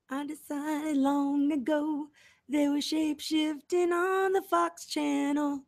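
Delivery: high and slow, a put-on eerie tone; slow high conspiracy voice; mock spooky voice. Shown as a demonstration. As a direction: draw it out high like telling a ghost story.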